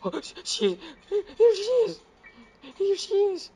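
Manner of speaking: loud, high stammering panic; squealed alarm at a sudden monster; panicked. Real, not acted. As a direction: gasp out rapid frightened ohs, voice leaping up. Granny appears in horror game.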